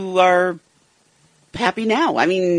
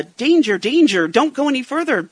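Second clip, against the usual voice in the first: warning cry; 6 semitones higher, 4 dB louder than usual. Retold or imitated. Imitating a warning sign.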